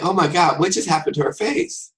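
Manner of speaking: high-pitched mock astonishment; hammed-up shocked aside; shocked onlooker. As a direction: react aghast at the sudden switch. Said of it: "I'm like".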